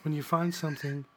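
man lightly voicing a woman; a hint of a woman's voice, laughing; girl voice.

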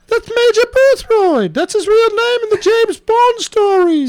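high, insistent British voice; Major Boothroyd. Acted. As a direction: explain in a high British character voice. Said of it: "I'm doing it in a British accent".

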